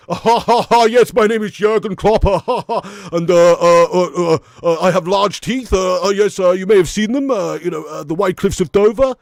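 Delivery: loud, bumbling big German man; Jurgen Klopp impression. Shown as a demonstration. Manager introducing himself to viewers, loud German impression. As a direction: boom it out with hearty ha-has and uhs. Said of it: "bumbling big German man".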